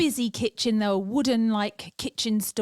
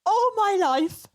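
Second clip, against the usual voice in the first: mortified; 8 semitones higher, slower than usual. Real, not acted. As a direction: cry it out in disbelief. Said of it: "embarrassed realisation".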